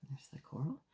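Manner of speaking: hushed little aside; whispered aside. Real, not acted. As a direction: whisper it, pointing at the picture. Reader aside pointing at picture.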